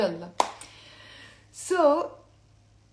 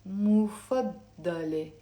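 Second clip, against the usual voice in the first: مفضلة drawn out as "mufad-dale"; slow, careful مفضلة; slow model for repeating; slower than usual. Shown as a demonstration. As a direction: say مفضلة slowly, clearly for learners to copy. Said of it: "Say with me out loud".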